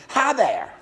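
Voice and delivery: delighted infant calling out to a stranger; baby's squeal of glee. Acted. Baby gleefully greets the man across the restaurant, squealing. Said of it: "Eric squealed with glee and said".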